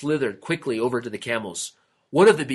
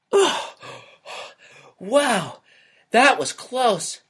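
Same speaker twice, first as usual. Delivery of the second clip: usual speaking voice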